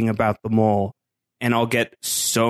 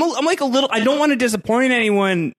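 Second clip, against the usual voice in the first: nervous; 8 semitones higher, faster than usual. Real, not acted. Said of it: "that's my constant fear of everything".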